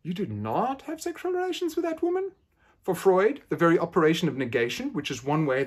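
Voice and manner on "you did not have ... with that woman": high rising question on woman; questioning echo. Shown as a demonstration. Analyst to patient, echoing his words as a high question. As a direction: lift the whole line into a probing question. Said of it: "just ask a question".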